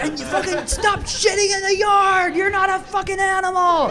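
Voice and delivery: high-pitched furious mom shriek; mom yelling. Retold or imitated. Mom catches kid in the yard mid-fight with dad, yelling. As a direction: scream it across the yard at your kid. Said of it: "she's yelling at my dad".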